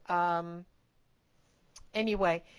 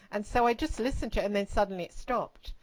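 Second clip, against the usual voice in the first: ordinary talk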